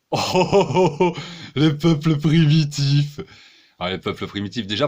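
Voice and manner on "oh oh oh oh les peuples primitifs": slow, loud, drawn-out sarcastic exclamation; mock awe. Mocking those who say primitive peoples, in drawn-out mock awe. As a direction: ham up fake wonder.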